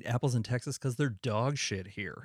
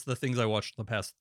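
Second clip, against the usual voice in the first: usual speaking voice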